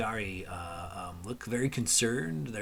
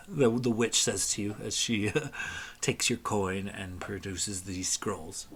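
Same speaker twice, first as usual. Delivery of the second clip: usual speaking voice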